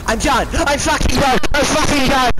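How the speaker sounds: loud, high rage yell; furious shout. Real, not acted. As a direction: explode at the game, full volume. Raging after dying again.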